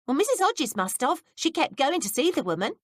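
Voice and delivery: put-on young maid voice. A narrator's reading. Betsy voiced by reader.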